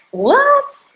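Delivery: sudden high squeak of disbelief; shocked what. Retold or imitated. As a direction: jolt up high in surprise. Sees second butterfly, shocked.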